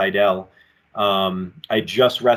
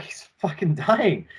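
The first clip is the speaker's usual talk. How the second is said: mock alarmed cry; 7 semitones higher than usual. Friend voices alarm on phone.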